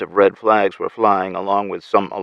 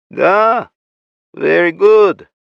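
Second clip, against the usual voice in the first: higher, louder, hint of a Russian accent; 11 semitones higher, 8 dB louder, much slower than usual. A narrator's reading. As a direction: let a Russian accent slip in. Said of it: "The Russian accent was starting to come out".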